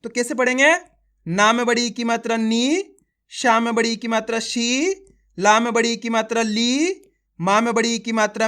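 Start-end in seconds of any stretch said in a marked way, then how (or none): 2.4-2.8 नी with a long, held ee; teacher's sustained नी; drawn-out long vowel. Shown as a demonstration. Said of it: "खींच खींच के पढ़ना है". 4.4-4.7 शी with a long, held ee; teacher's sustained शी; drawn-out long vowel. Shown as a demonstration. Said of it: "खींच खींच के पढ़ना है". 6.4-7.0 ली with a long, held ee; teacher's sustained ली; drawn-out long vowel. Shown as a demonstration. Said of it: "खींच खींच के पढ़ना है".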